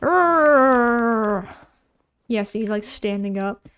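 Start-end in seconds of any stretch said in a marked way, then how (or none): 0.0-1.6 mock angry bear roar; hammed-up bear snarl at the player; bear growl. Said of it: "They're like".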